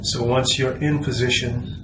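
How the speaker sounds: soft, unhurried body-scan voice with long pauses; guided meditation. Acted.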